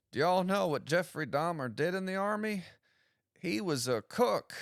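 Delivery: high, hammy stand-up delivery; bad comedian voice. Shown as a demonstration. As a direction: play the awkward bad comic. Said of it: "That joke was in poor taste".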